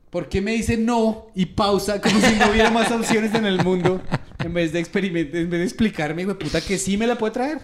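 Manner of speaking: hammed-up rant at the waitress, laughing through it; retold indignant rant. Retelling his outburst at waitress.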